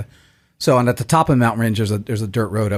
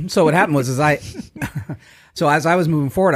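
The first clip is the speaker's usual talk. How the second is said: ordinary talk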